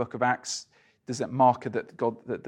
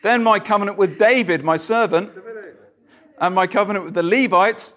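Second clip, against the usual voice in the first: louder, declaiming Bible reading; scripture reading; 5 semitones higher, 5 dB louder than usual. Acted. Preacher reading Jeremiah to the congregation, louder declaiming. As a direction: proclaim the passage to the room.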